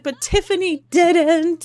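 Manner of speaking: high, whiny tearful protest; mock sobbing. Kevin answers his comforting friend Heather, mock sobbing. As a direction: act out sobbing self-pity. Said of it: "he's sobbing".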